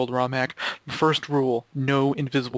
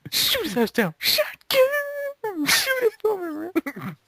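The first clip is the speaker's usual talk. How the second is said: very high, excited yell; hyped remix quote; much slower than usual. Retold or imitated. Gamer quoting his remixed Shotgun line, very high. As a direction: belt it like a hyped remix hook. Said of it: "referencing some song called Shotgun".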